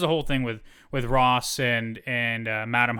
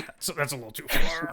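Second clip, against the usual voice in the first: laughing; 3 semitones higher than usual. Real, not acted. Laughing after a joke.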